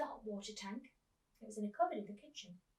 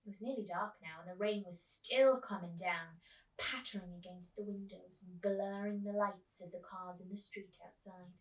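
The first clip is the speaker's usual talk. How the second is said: ordinary delivery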